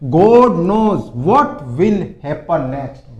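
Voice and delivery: very slow and loud; word by word for learners; slow dictation; slow model sentence. Shown as a demonstration. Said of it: "इसको क्या बोलते हैं".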